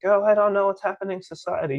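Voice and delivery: high, loud mocking whine; mock cluelessness. Mimicking people baffled by society, mock bewilderment.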